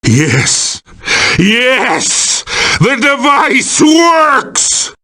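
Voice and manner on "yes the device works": high, drawn-out villain exultation; triumphant cry. Acted. Villain as the device works, triumphant cry. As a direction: crow in triumph, pitched right up.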